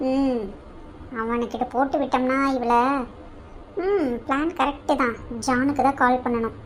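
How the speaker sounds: soft, slow villainous plotting under the breath; quietly hatch the plan, savouring it; scheming to herself. Acted. Scheming alone against the couple. (மெதுவாக, தாழ்ந்த குரலில் சதித்திட்டம் போடுதல்)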